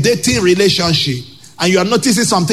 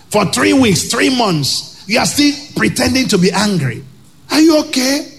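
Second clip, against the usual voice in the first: higher, raised voice scolding the sulker; rising rebuke; 7 semitones higher than usual. Real, not acted. Preacher scolding a sulking spouse, raised rebuke. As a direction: call out the pouting spouse.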